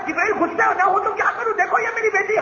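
high, exasperated protest; angry complaint. A narrator's reading. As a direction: complain heatedly about a daughter, high-pitched. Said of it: "تھے ہی ناراض". (ناراضی میں اونچی آواز)

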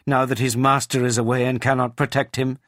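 high, forceful outcry; indignant cry. A narrator's reading. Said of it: "she cried".

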